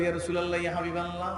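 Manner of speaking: softly intoned respectful address. Acted. Companion addressing the Prophet, soft address. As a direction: call on him gently, keeping the volume down. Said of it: "সাহাবী গিয়ে বলে".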